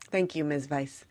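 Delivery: usual speaking voice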